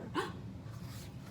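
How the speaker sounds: hushed whisper gasp; playful shocked gasp; mock gasp. Gasp at mismatched socks.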